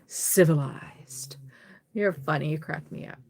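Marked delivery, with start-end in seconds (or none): 0.1-1.4 breathy whispered echo of the word; slow teasing whisper; mock whisper. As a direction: whisper it back slowly, teasing. Repeating the commenter's word.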